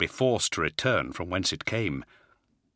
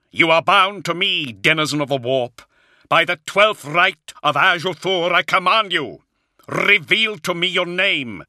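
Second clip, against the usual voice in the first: high, loud voice of an exorcist; ringing, forceful incantation; shouted command; 9 semitones higher, 7 dB louder than usual. A narrator's reading. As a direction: shout down a demon with full authority. Luther commands the demon.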